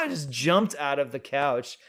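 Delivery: usual speaking voice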